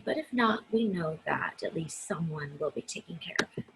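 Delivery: ordinary delivery, nothing marked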